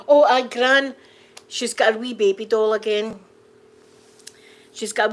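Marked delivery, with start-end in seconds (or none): none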